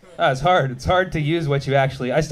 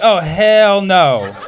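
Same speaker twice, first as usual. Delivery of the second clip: slow, drawn-out oh hell no, louder; indignant oh hell no; 5 dB louder, much slower than usual. Retold or imitated. Comic retelling the falafel scene, slow indignant oh hell no. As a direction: stretch out each word in mock outrage. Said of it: "I was like".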